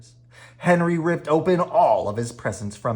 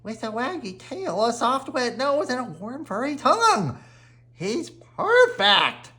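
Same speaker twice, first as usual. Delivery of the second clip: put-on much higher, gushing kid voice; delighted child; 12 semitones higher than usual. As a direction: gush over the puppy, slowing at the end. Henry describing new dog.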